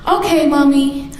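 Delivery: put-on high, childlike okay mommy; mother voicing her small son, eager to please; sweet little boy voice. Little son promising his mother, in a high childlike voice.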